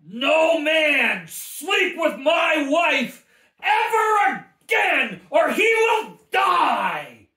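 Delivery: 2 a very loud, high, slow proclamation; 3 roar it out like a vow before God; 4 a husband bellowing a holy decree; 1 fierce shout. Real, not acted. Declaring over his wife.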